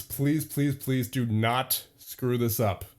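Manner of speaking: ordinary delivery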